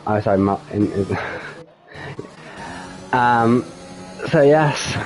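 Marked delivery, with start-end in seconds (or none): none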